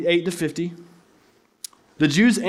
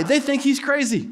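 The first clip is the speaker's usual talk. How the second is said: ordinary talk, nothing marked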